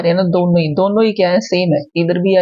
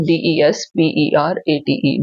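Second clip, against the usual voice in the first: letters spelled out slowly; teacher repeats the spelling slowly; slow reread spelling; 2 semitones lower, much slower than usual. Shown as a demonstration. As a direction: read the spelling again, letter by letter. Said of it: "दोबारा पढ़ देती हूँ".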